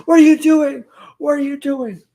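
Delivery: high, loud shocked cry, imitating Jay; mock shocked yell. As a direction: act stunned by the betrayal. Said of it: "And Jay is like".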